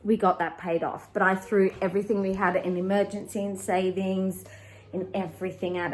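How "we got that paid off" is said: ordinary talk, nothing marked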